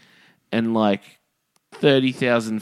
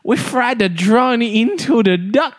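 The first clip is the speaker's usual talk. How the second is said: very high, slow, loud comic voice through laughter; high shopkeeper caricature; 14 semitones higher, 4 dB louder than usual. Acted. Shopkeeper apologising to customer for the drone delay, very high voice. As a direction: squeal the apology, cracking up.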